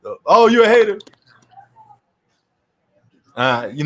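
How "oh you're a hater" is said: high, loud, indignant jab of "hater"; mock accuser. Mocking critics who call him a hater, high and loud. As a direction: throw the accusation back like a whiny critic. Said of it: "if I make a statement, say".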